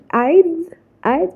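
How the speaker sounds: high, loud disbelieving echo; incredulous. Real, not acted. Vlogger mocks her own spelling mistake in disbelief. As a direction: react in mock shock.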